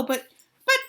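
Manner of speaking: high panicked stammer. A narrator's reading. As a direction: stutter in alarm. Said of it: "He stammered".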